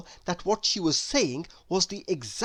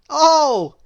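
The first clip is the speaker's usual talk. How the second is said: a loud, high oh of discovery; the penny drops; sudden realisation; 9 semitones higher, 11 dB louder than usual. A narrator's reading. Realisation, he smiled.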